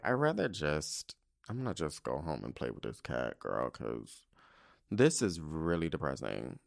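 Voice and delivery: low, soft, resigned murmur; deflated giving up. Retold or imitated. Woman tells herself she'll go home to the cat, low deflated voice. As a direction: sink down into a quiet, defeated aside. Giving up on date, depressed aside.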